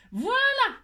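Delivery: high, loud cry of triumph; triumphant. Real, not acted. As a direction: exclaim it, thrilled it worked. Triumphant exclamation.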